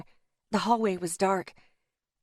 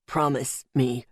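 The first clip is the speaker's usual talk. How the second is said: low, slow dying gasp; 8 semitones lower, slower than usual. A narrator's reading. Dying Louis begging for a promise, low slow gasp. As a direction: strain out each word, deep and halting. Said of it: "he gasped".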